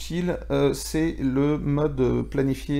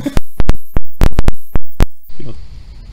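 loud laugh; 14 semitones higher, 16 dB louder than usual. Real, not acted. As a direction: burst of high, loud laughter. Burst of laughter at a mix-up.

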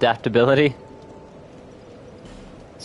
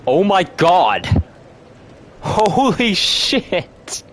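high, drawn-out amazed exclamations; real astonishment; 9 semitones higher, much slower than usual. Real, not acted. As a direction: stretch each word in stunned disbelief. Astonished by amount of levels.

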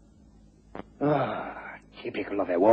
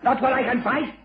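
desperate defiance; 12 semitones higher than usual. Acted. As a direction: refuse to give up, voice climbing. Cornered villain resists arrest.

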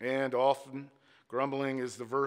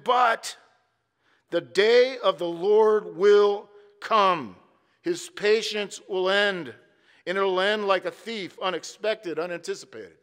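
loud, high, slow proclamation of doom; drawn-out fiery sermon climax; thundering preaching; 7 semitones higher, 9 dB louder, slower than usual. Acted. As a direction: bellow the warning from the pulpit like a prophet. Preacher's climax on coming judgment.

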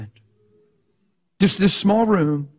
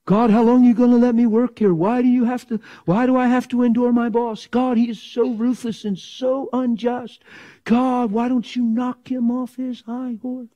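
mock complaining prayer; 6 semitones higher than usual.

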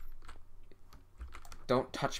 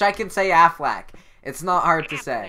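loud; 7 semitones higher, 12 dB louder than usual. Real, not acted. Insists he can say Aflac.

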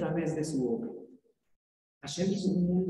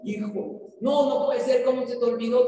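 upset mother; 6 semitones higher, 5 dB louder than usual. Retold or imitated. Mother learns key forgotten.

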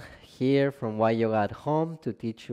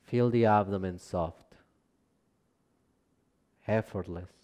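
calm, measured relaxation guidance, phrase by phrase; guided meditation; 3 dB quieter than usual. Acted. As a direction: guide them gently down into rest, unhurried and spacious. Leads a yoga nidra practice.

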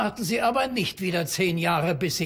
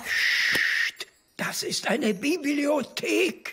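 hushed, slow, high scolding; librarian shush; 6 semitones higher, much slower than usual. Acted. Librarian hushing the cheer.

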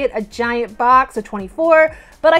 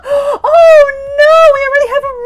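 high, louder wail of oh no; playful anguish at a repeat; loud, high mock cry; mock despair; 10 semitones higher, 9 dB louder than usual. Real, not acted.

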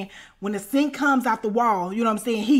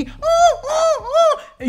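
high squealing ooh sounds, hammed up; mock porn moans; 4 dB louder, much slower than usual.

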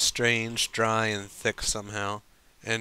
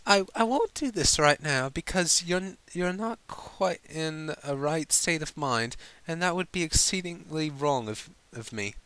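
flustered lady; 7 semitones higher than usual. Acted. Flustered Rarity stammers.

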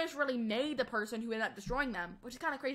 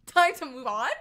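laughing through Time to move on?, louder; 9 dB louder than usual. Real, not acted. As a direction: tease Dad while laughing. Teasing dad's subject change.

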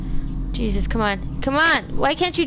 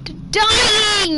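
anguished cry; 5 semitones higher than usual. Real, not acted. Keeps dying in game.

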